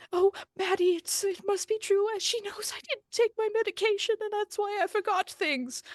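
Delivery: put-on high, pleading old woman's voice; pleading Penelope. Penelope begs the medium to confirm the dog forgives.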